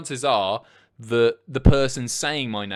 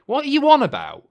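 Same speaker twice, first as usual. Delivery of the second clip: high, loud disbelieving question; incredulous outburst; 13 semitones higher, 9 dB louder than usual. Real, not acted. Streamer baffled by [name] film, incredulous. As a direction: blurt it out, pitch leaping up.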